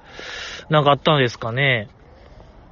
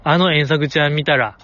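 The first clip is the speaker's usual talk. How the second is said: ordinary talk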